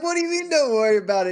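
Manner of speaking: mock disbelief. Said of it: "And I'm like".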